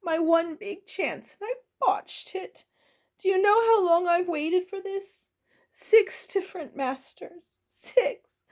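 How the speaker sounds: crying goblin cook. A narrator's reading. Goblin cook mourns her one big chance, crying out. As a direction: wail despairingly, rising to a loud peak. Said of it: "cried Orla".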